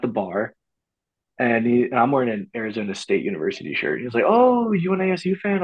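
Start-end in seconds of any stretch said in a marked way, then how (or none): 4.3-5.6 high-pitched quoted question; eager stranger. Retold or imitated. Stranger at the bar asking about his college shirt, high and eager.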